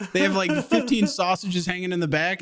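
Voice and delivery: ordinary delivery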